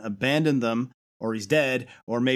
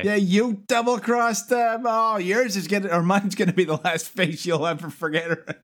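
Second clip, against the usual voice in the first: mock threat; 8 semitones higher than usual. Miko taunting Megatron, lightly mocked. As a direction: taunt him in a hammed-up kid voice.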